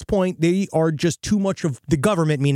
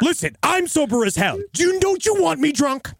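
mock indignant; 10 semitones higher than usual. Voicing the speeding driver to cop.

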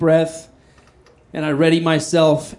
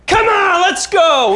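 much higher, louder battle cry; fight challenge shout; 5 dB louder, slower than usual. Retold or imitated. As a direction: roar it out like you're ready to brawl. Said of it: "I just fucking Hulk out".

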